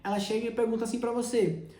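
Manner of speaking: ordinary talk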